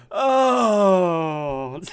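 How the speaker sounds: long loud falling "oh"; loud, drawn-out cry; laughing wail. Real, not acted. Wails after laughing fit.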